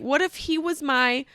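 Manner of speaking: ordinary delivery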